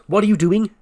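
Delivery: high, loud startled question; acted outburst, loud and high; startled demand. Startled character's demand in retold scene.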